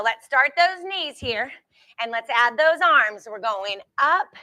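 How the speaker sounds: ordinary delivery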